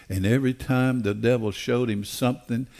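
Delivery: usual speaking voice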